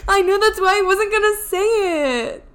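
high, loud, tearful protest; loud distressed apology through tears; near tears. Real, not acted. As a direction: plead while about to cry. Upset, both laughing crying.

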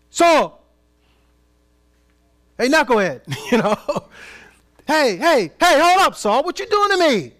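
loud, high shout; God yelling at Saul. Pretend. God shouting at Saul to hold up, loud and high. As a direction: yell it out at the knucklehead. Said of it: "Or did he say".

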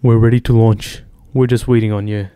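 loud, put-on British announcement; British accent. Shown as a demonstration. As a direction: declare it in a mock British accent. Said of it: "say this in a British accent".

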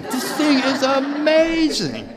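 amazed shout. Retold or imitated. Repeating his amazement at the message, shouted with a laugh. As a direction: belt it out in laughing wonder. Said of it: "I go".

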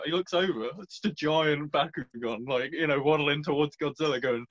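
ordinary talk

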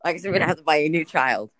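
fast joking apology. Pretend.

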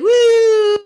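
loud, very high sustained woo; deflating balloon noise. Retold or imitated. Showing her excitement deflating like a balloon, high whoop. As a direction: imitate air escaping a balloon, high and loud.

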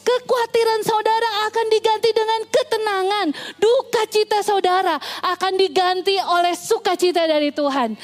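high-pitched, declarative sermon delivery; impassioned preaching. Real, not acted. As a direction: proclaim a promise to the congregation. Preacher declares a promise to congregation.

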